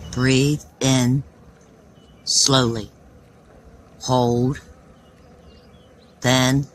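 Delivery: slow, measured meditation voice; unhurried breath-by-breath guidance, words spaced out; guided breathing. Acted. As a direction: lead a breathing exercise with long pauses. Leads slow breathing exercise.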